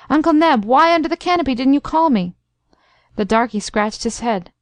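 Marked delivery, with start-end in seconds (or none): 0.1-2.3 raised, higher-pitched calling out; wild calling. A narrator's reading. The Major at the door to his driver, calling wildly. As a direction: call out urgently, pitch up high. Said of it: "calling wildly to the negro".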